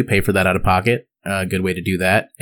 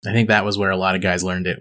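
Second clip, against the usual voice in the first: ordinary talk, nothing marked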